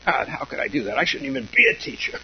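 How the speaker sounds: exaggerated anguished self-reproach, rising high; mock self-scolding teacher. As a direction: ham up a teacher berating himself. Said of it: "And then we say".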